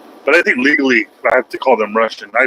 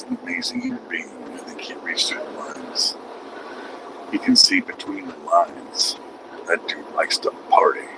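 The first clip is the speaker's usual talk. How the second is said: soft unvoiced whisper, much softer; 7 semitones lower, 10 dB quieter than usual. Real, not acted. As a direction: lean in and whisper it like a secret. Hushed aside about Doomsday.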